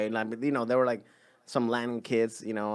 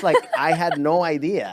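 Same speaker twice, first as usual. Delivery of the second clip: normal talking voice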